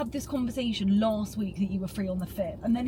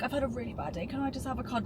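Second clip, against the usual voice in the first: quiet mopey imitation of him, softer; mock sad whine; 9 dB quieter than usual. Girlfriend mimicking her boyfriend's self-pity, soft mock whine. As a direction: whinge it softly like a sulky boyfriend. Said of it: "he was like".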